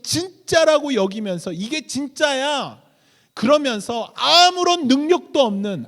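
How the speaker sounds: loud, high-pitched sermon delivery; forceful preaching. Acted. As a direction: drive each phrase home at full preaching force.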